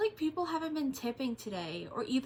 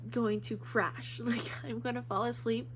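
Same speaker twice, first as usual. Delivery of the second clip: normal talking voice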